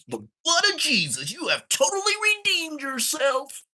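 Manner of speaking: mock church proclamation, loud and high; preacher. Praising the fighter's comeback, in mock preacher voice.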